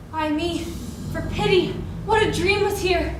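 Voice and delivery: usual speaking voice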